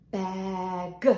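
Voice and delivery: BAG lengthened with a separate released G; long vowel in Bag, then a distinct G; word modelled. Shown as a demonstration. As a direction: lead a slow Bag for children to copy. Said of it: "Let's say that word together".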